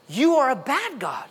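high, drawn-out mock accusation; accusing complaint. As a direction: act out grumblers blaming God. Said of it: "they grumbled and complained".

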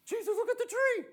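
high gasp bursting into a loud cry; hammed-up amazement, voice leaping way up; astonished. Peter pointing Jesus to the withered tree, astonished.

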